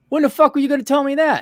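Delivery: high-pitched, fast indignant outburst; exasperated retort. Retold or imitated. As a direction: retell it as an outraged demand. Retelling his outraged reply to installers.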